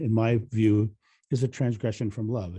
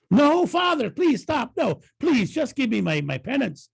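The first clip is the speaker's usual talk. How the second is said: frantic begging; 13 semitones higher, 5 dB louder than usual. Acted. Sean begs the priest to stop.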